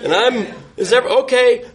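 mock shout, pitched high without much force; retold calling out. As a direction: imitate shouting to someone out of sight. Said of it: "I try shouting to her".

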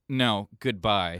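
loud, slow, final brush-off; drawn-out, dismissive no and goodbye; impatient businessman. Acted. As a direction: shut them down as a curt businessman. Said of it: "short, uh, patience businessman just being like".

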